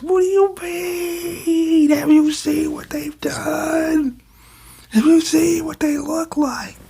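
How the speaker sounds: hammed-up high spirit voice; high creepy spirit voice. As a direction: whisper it high and eerie like a monster. Mock voicing a cursed spirit.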